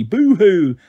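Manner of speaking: hammed-up boo-hoo; lightly mocking wail; mock baby crying. A narrator's reading. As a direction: mimic a bawling baby, mockingly. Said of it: "cried like a baby".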